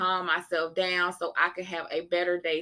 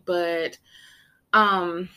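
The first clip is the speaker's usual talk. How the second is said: normal talking voice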